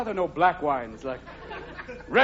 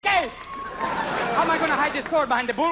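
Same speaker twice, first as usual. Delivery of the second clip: mock bullfighter shouting; 11 semitones higher than usual. Bullfighter yelling about his shrunken cape, high mock outrage.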